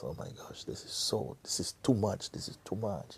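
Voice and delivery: breathy worried whisper; anxious whisper. Retold or imitated. The man frightened about his last thought, whispering. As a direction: fret to yourself under your breath.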